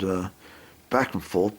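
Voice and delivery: normal talking voice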